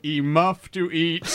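ordinary talk

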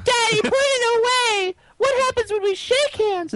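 put-on very high, wailing little-kid voice; hammed-up squeal of a distressed child; frightened child. Voicing the children begging dad to put it away, mock-terrified child. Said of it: "And they're like".

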